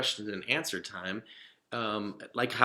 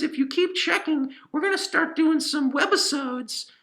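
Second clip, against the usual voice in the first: higher, upbeat cartoon cat pitching videos; Pippin the cat puppet; 9 dB louder than usual. Cat tells kids where to find his videos, higher and upbeat. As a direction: sell it bright and high like a kids' show mascot.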